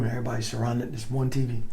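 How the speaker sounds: ordinary delivery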